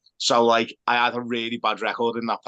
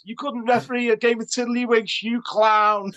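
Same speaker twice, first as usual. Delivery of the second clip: mock fan heckle; 11 semitones higher than usual. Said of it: "And then he says".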